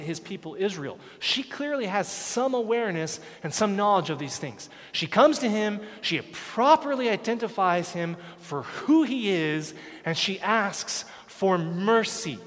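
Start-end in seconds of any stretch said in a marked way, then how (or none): none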